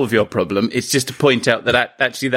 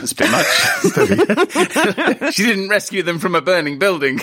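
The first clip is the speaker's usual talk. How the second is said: laughing; 7 semitones higher, slower than usual. Real, not acted. Laughing at over-the-top suggestion.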